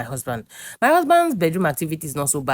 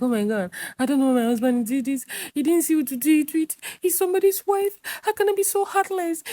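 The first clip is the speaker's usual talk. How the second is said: high, whimpering mock sob; hammed-up teary wail, pitched way up; fake crying; 13 semitones higher than usual. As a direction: put on a pitiful fake cry to win sympathy. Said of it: "I'll start to fake cry".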